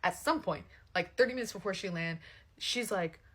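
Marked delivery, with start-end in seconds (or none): none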